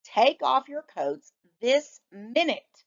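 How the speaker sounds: a touch of a stern mother; firm mother. Acted. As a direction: order it like a mom. Mother ordering.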